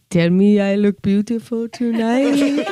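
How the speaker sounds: slow mock French seduction; seductive French accent. Wife trying to seduce her husband on air, slow mock French accent. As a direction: drawl it slowly in a hammy French accent to seduce him. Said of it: "attempt to seduce your spouse".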